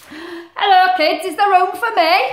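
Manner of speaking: loud, high goofy moose; a big friendly moose calling out to the kids. Acted. Moose greeting the children, high and loud.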